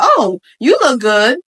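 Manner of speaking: slow compliment with a lifted, interested "oh"; admiring tone. Shown as a demonstration. Showing how tone changes meaning, admiring compliment. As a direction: lift the praise so it sounds meant. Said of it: "See how the tone is different?".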